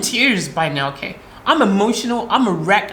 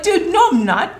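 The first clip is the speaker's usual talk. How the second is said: exasperated protest; 8 semitones higher than usual. Retold or imitated. Protesting to the cop that she did nothing wrong, pitched up high and indignant. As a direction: pitch jumps up on a sharp protest.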